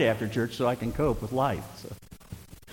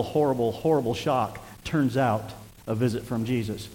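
normal talking voice

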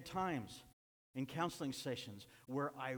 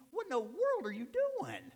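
pleading cry; 8 dB louder than usual. Retold or imitated. Pastor pleading with a straying man, high and tearful. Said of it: "And I said".

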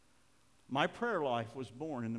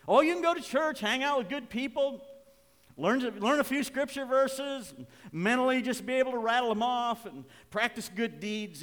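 loud, high-pitched sweep through a list; raised preaching; 11 semitones higher, 9 dB louder than usual. Real, not acted. Preacher lists churchgoing habits to congregation, raised preaching. Preacher voicing a shallow alternative to prayer.

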